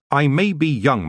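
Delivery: high, firm and steady; firm young witch. A narrator's reading. Tiffany facing the king, firm and high. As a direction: hold your ground. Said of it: "she said firmly".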